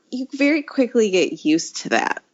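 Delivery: ordinary delivery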